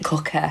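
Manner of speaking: "cookie" quietly imitated in the other speaker's accent; copying the accent. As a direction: softly echo "cookie" with a long "oo" as in "cook-eh". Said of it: "It's so cool, though, how you say".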